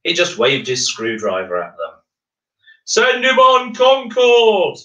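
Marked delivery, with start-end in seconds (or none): 2.8-4.8 much higher, louder and slow crowd shout. Acted. A man in the crowd yells at Miles, loud slow shout. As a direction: yell it over the crowd. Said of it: "shouted a man in the crowd".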